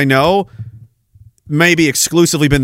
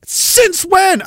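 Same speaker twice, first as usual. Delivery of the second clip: high, shocked question; surprised; 12 semitones higher than usual. Pretend. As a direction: gasp it out in disbelief. Acted naive questioner.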